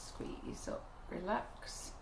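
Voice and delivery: soft whisper, almost unvoiced. Shown as a demonstration. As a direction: breathe the words like a secret. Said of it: "repeats instruction softly".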